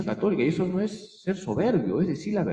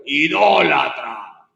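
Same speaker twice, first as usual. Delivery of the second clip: slow, drawn-out accusing shout; mock yell of idolater; retold loud yell; 5 dB louder, much slower than usual. The woman shouting at the priest again, slow and loud. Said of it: "me dijo fuerte".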